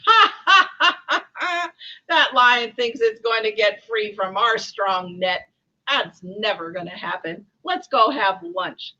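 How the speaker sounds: loud stagey ha-ha-ha, then gloating; laughing villain. Acted. Poachers walking away from the trapped lion, laughing. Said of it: "The poachers walked away laughing".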